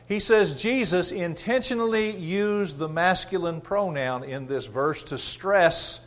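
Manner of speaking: ordinary talk, nothing marked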